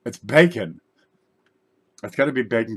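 excited. Real, not acted. Tastes the bacon lip balm.

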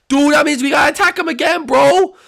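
high, loud and frantic; acted high-pitched alarm; alarmed urging. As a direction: urge a friend on in a panic. Urgent reaction to the attack.

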